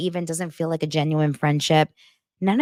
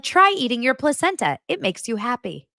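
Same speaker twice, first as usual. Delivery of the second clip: 1 imitating other mom; 7 semitones higher than usual. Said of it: "she's like".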